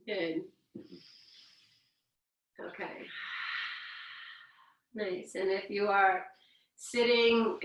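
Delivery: calm, unhurried instructor voice leading breaths; guided breathing. Acted. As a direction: guide the class gently through the yawn.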